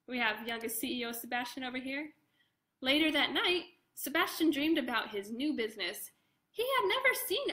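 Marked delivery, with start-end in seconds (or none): none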